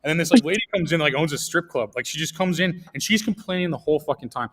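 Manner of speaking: ordinary talk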